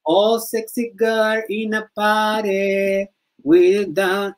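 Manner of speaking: high, slow sing-song English; imitating English singer. Interrupting Gio to imitate a singer, slow and high. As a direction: camp mock English line. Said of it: "yo la imitaba".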